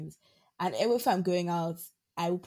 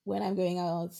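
ordinary talk, nothing marked

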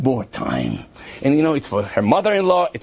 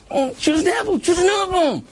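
high, fed-up mock sigh of a reply; exasperated; 14 semitones higher than usual. Storyteller mocks his own exasperated reply to her, high and fed up. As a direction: roll your eyes through it.

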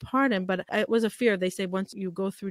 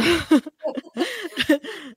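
burst of laughter; 8 semitones higher, 7 dB louder than usual. Real, not acted. Laughs at husband wanting ten.

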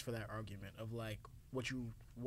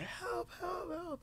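very high, thin mock scream; imitated screaming; much slower than usual. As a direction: falsetto cry for help at ordinary volume. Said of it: "he was screaming in the phone".

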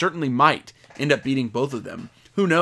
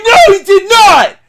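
slow, very high shouting; over-the-top fanboy screaming; screamed disbelief; 12 dB louder, slower than usual. Retold or imitated. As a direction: bellow each word in stunned denial. Reenacting reaction to the attack.

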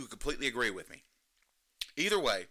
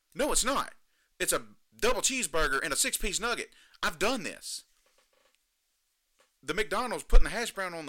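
worked-up rant, higher and louder; 5 semitones higher, 6 dB louder than usual. Real, not acted. Podcast host ranting at McDonald's menu hacks, loud indignant rant. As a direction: build into an indignant tirade about the fake specials. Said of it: "I'm done ranting. I'm done raving".

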